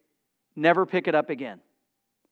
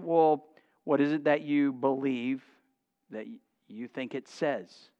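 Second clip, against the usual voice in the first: low, quiet counselor's question; hushed, lower-pitched probing; measured questioning; 6 semitones lower, 7 dB quieter than usual. Retold or imitated. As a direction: ask it softly and carefully, tapering down. Acts out gently questioning a counselee.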